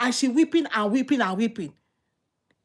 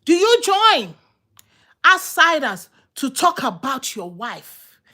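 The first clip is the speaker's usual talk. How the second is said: fired-up preaching; 3 semitones higher, 4 dB louder, slower than usual. Real, not acted. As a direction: hammer the question home, all fired up. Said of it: "I told you I'm fired up".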